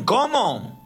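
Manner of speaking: mock surprise. Priest confronts the shouting woman.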